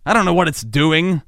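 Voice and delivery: ordinary delivery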